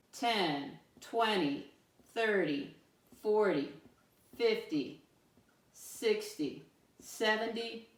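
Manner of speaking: evenly spaced count with clear pauses; counting aloud. Shown as a demonstration. Teacher asks pupils to help count up, spaced counting aloud. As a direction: count up one number at a time for others to join. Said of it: "Help me count them up again".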